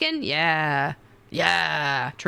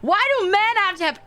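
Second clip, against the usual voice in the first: high, hammed-up indignant rant; playful over-the-top complaint, obviously joking; mock outrage; 11 semitones higher than usual. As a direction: rant in pretend fury, pitched way up. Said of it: "I'm just kidding".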